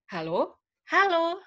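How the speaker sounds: drawn-out, high sing-song "halo"; varied intonation. Shown as a demonstration. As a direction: swing each "halo" up high and hold it. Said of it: "bisa dapet banyak banget intonasi".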